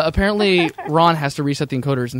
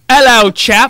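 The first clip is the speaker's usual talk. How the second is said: a loud, high hint of a British accent on "Hello, chap"; mock British greeting; 7 semitones higher, 7 dB louder, much slower than usual. Friend retelling meeting her British dad, loud mock British accent. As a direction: play the posh British dad greeting you. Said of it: "he's like".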